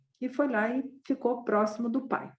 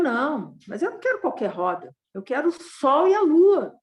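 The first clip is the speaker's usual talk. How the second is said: rising to a louder, higher demand for the sun and moon; insistent demand; 6 semitones higher, 8 dB louder than usual. Acted. Son to grieving father, demanding the sun and moon, insistent raised voice. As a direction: push the wish up, childlike and insistent.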